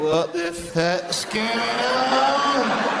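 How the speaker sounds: high, rising outcry of mock disbelief; appalled onlooker. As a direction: build from stunned drawn-out words to a high-pitched exclamation. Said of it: "if you'd have seen your eyes then going".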